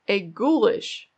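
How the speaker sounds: ordinary delivery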